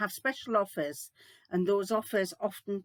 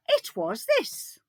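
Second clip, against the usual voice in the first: squeaky high mock voice of Seaside Mum; imitating a viewer; 4 dB louder, slower than usual. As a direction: pitch it way up, teasing. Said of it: "she will say".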